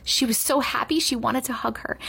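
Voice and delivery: usual speaking voice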